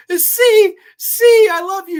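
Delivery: imitating a tied-up victim crying love under threat; terrified crying plea. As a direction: wail it out in terror. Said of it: "she's crying her eyes out".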